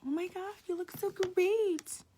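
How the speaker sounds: high, slow, put-on gushing voice; mock gushing compliment. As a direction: play an overexcited friend fawning. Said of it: "everyone be like".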